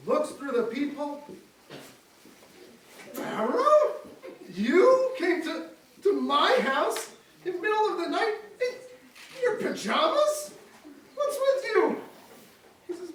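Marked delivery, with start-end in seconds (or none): 3.1-12.0 higher, slow, laughing astonishment; amused disbelief. Acted. Moses finds Pharaoh at his door, amused disbelief. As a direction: marvel at the king in pajamas.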